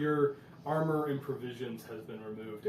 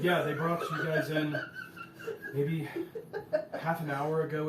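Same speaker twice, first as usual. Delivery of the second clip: laughing prisoner; 2 semitones higher, 5 dB louder than usual. Acted. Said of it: "She said".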